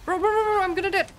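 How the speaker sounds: high-pitched panicky stammer; panicked rambling. Real, not acted. Player about to die in game, high panicked chant. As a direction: rattle out a rapid high string of alarm.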